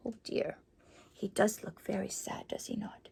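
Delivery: a barely voiced, sympathetic oh dear; soft sad sigh. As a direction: sigh it quietly for the lonely man. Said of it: "sad aside to listeners".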